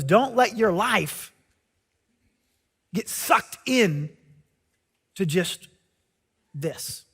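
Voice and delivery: slow, fading earnest plea; slow it down and let it drop softly; earnest warning. Retold or imitated. Preacher quoting a warning to the congregation, slow and soft.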